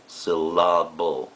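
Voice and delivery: syllable with a long, stressed middle: syl-LAH-ble; stress on second syllable. Shown as a demonstration. Teacher shows students where accent falls, stressing the middle of "syllable". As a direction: stretch and punch the middle of "syllable". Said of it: "we accent sylla-, the second".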